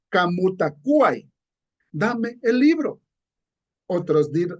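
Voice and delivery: ordinary talk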